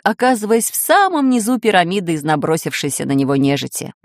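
ordinary delivery

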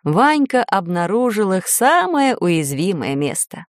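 ordinary delivery

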